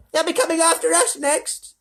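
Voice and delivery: high, loud shriek; yelling grandma. A narrator's reading. Grandma Georgina yells at Wonka to reverse, high shriek. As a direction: bark it in panic. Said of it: "yelled Grandma Georgina".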